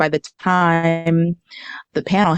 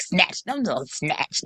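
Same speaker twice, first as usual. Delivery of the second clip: mock mutter; 8 dB quieter, slower than usual. Imitating her friend muttering to herself before the interview, soft. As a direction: mimic someone rehearsing under her breath.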